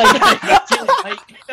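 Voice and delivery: high, stretched laughing plea. Real, not acted. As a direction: laugh through the words. Guest cuts in, host laughs.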